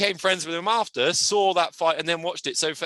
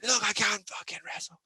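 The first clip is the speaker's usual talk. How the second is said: higher, muted imitation of an annoyed fighter; mock indignant; 8 semitones higher, 4 dB quieter than usual. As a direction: retell the complaint in a strained, held-down voice. Retelling fighter's angry comeback after fight.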